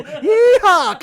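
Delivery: high whooping cowboy holler; old prospector yeehaw. Pretend. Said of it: "That's who I am as a show".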